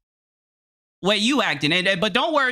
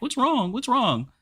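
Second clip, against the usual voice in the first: higher, mock-concerned "what's wrong"; fussing men; 5 semitones higher, slower than usual. Streamer mocking men who fuss over a crying woman, higher mock concern. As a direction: imitate a guy rushing over to fuss. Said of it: "men everywhere are gonna be like".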